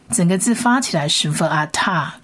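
ordinary delivery

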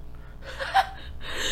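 high, breathless squeal of laughter. Real, not acted. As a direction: laugh in disbelief, almost crying. Reacting to the stingy boyfriend story. (难以置信的尖声大笑)

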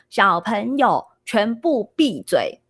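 put-on child slowly aping a stern teacher's order, each word drawn out; 小孩慢慢模仿严厉老师; mimicking a strict teacher. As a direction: play a little boy copying his teacher's command, slow and deliberate. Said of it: "他就模仿老师手放背后".

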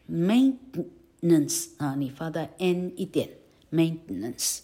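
maintenance said slowly, "maint-n-ance"; slow syllabic n. Shown as a demonstration. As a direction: stretch maintenance, n as its own syllable.

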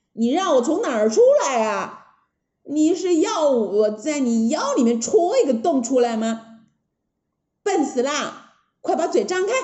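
cheeky, exasperated hero voice; impatient Monkey King. Acted. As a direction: scold the princess impatiently. Wukong scolds the princess.

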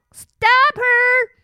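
high, loud alarm call; queen shouting. Acted. Queen seeing princess escape, shouting in alarm. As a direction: cry out to have someone caught. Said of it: "shouted the queen".

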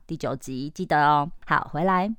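ordinary talk, nothing marked

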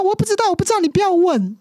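high, fretful child's voice, lightly imitated; a child who doesn't want to be asked; upset little daughter. Daughter answering mother, high and upset.

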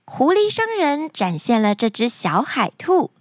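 usual speaking voice

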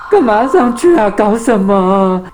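high, drawn-out distressed wail; tearful cry. Acted. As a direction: protest as if close to tears. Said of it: "滴子哭着喊道".

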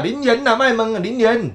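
林园呐 with a sliding tone, pitch up; imitating a Linyuan friend's glide on 林园; mimicked Linyuan accent. Friend from Linyuan imitated, 林园 with a gliding tone. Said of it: "你对的人啊".

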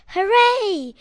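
loud, high cheer. Acted. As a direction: cheer it out together. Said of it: "Everyone wakes up and shouts".

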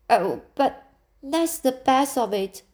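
a light touch of an eager protest. A narrator's reading. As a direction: object with girlish eagerness. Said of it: "protested Anne".

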